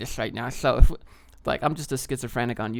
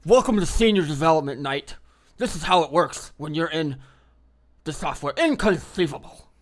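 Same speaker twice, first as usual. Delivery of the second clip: a louder, higher, pompous host voice; senior developer voice; 4 semitones higher, 5 dB louder than usual. Acted. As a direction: announce it like a smug senior dev. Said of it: "Oh my God, the voice".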